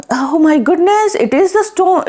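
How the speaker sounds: high-pitched acted amazement; voiced disbelief, pitch leaping up; astonished mother. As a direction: gasp it out in mock astonishment. Mother acted seeing the gold.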